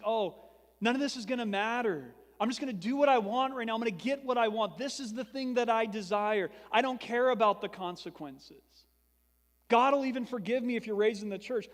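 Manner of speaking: mock the reckless young voice that thinks nothing matters; careless youth. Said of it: "we just think".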